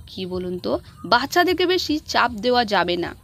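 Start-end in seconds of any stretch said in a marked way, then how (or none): none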